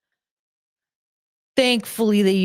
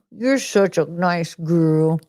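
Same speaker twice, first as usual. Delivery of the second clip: ordinary delivery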